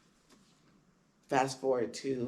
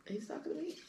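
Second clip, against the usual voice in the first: much higher and softer, incredulous; stunned disbelief; 13 semitones higher, 10 dB quieter, faster than usual. Retold or imitated. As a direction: ask it as if you can't believe your ears. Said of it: "And I'm like".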